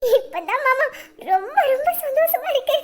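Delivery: high, tearful voice laughing through tears; happy tears. Acted. Bride to her fiancé, laughing through happy tears. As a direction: choke up with joy while laughing. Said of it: "ஏண்டி அழுவுற?".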